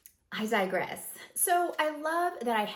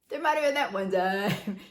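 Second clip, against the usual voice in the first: laughing confession; 7 dB louder than usual. Real, not acted. Laughing confession of a naked moment.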